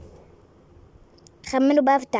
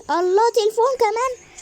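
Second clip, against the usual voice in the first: delighted surprise; 10 semitones higher, 3 dB louder than usual. Real, not acted. Discovers phone in game.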